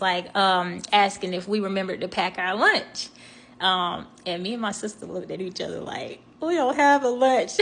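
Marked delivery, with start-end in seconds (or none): 6.4-7.5 higher and louder, mock alarm; dismayed realisation. Sisters look at each other, realising no lunch, mock alarm. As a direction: play the sudden oh-no moment, half laughing.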